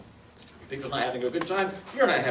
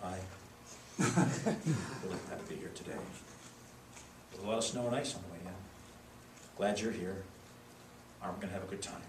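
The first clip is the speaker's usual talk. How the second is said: mumbled, soft and lifeless; flat low-energy demo; 8 semitones lower, 13 dB quieter, faster than usual. Shown as a demonstration. Speaker demo for judges, timid and soft. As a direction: dull speaker barely awake. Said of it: "Really? Are you having a good time?".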